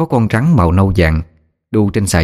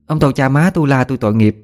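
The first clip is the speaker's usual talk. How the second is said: raised, pleading call to the spirits, pitch lifted; mother calling out loud; 5 semitones higher than usual. Acted. Mother calling to the field ghosts, raised pleading. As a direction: plead aloud to unseen ghosts, a touch hammed. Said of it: "Mẹ đứng đó nói lớn".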